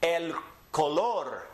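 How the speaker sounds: slow El color, el ko-LOR; Spanish pronunciation. Shown as a demonstration. Teacher models for repeating, El color slowly stressed on LOR. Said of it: "Repeat after me".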